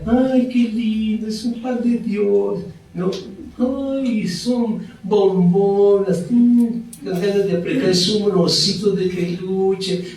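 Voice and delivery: mock syrupy praise; doting sweet talk. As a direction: coo like a doting churchgoer. Mocking sweet-talk imitation in church.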